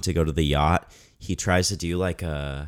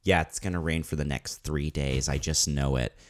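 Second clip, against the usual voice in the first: mock glum certainty; 5 semitones lower, 4 dB quieter than usual. Retelling Colleen's dreary forecast.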